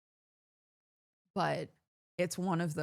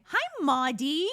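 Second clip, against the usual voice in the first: high, louder sing-song Hi Maudie; sing-song greeting; 9 semitones higher, 5 dB louder than usual. Retold or imitated. Husband greeting the dog, mocking her high sing-song. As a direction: chirp it like calling the dog. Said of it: "Clark has been saying".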